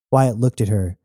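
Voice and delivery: usual speaking voice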